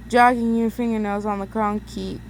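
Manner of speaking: usual speaking voice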